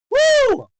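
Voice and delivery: high, loud "woo"; excited whoop. Real, not acted. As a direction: whoop like a thrilled fight fan. Cheering the fight.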